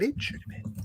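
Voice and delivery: breathless whisper aside. Real, not acted. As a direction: drop to a whisper on "but it should have been". Whispered aside, then repeated aloud.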